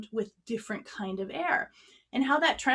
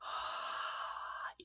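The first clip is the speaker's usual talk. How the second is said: long, soft, lazy exhale; slow breath; 13 dB quieter than usual. Shown as a demonstration. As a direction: let the air drift out slowly and gently. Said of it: "This is what slow air sounds like".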